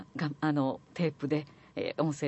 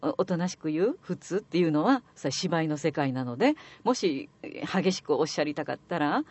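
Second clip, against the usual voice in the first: ordinary talk, nothing marked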